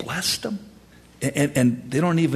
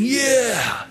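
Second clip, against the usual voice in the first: high, drawn-out cheer; hammed-up keen yes; mock enthusiasm; 3 dB louder than usual. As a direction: agree eagerly like an easily roused crowd. Mimics people's empty enthusiastic agreement.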